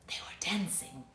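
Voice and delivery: a hushed whisper; whispered reveal. Acted. Narrator revealing the dinosaurs' secret, whispered. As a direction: whisper the surprise.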